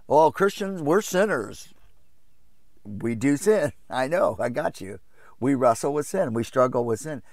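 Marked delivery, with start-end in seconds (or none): 0.1-1.7 lightly voiced protester, pitched higher; mock a defensive churchgoer, voice lifted; objecting believer.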